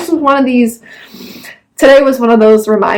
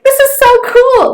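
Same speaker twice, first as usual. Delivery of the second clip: excited; 12 semitones higher than usual. Real, not acted. Excited reaction to free chocolate.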